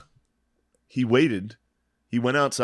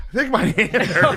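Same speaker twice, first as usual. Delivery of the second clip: higher, laughing imitation of a woozy mom; dazed mom; 10 semitones higher than usual. Mom sitting up after the fall, high laughing mock daze. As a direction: play the just-woken mom, small and pitiful.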